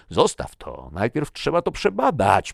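loud warning climbing high; forceful, rising protest. A narrator's reading. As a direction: raise your voice to stop him. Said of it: "podniósł głos Icke".

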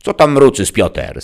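high, loud scolding granny; hissing grandma. A narrator's reading. Grandma catching Piotr mumbling, sharp hiss. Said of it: "syknęła babcia".

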